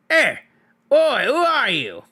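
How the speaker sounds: high squeaky little voice; squeaking purse. Acted. Talking purse alarms the troll, high squeaky cry. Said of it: "it squeaked".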